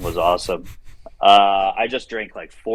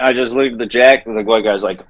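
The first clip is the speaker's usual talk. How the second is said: ordinary talk, nothing marked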